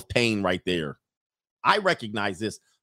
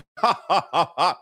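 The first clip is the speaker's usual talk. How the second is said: high fake staccato laugh; mock proud-parent forced chuckle; marital laugh of doom; 4 semitones higher than usual. Bragging about little daughter, fake doom laugh. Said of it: "The marital laugh of doom".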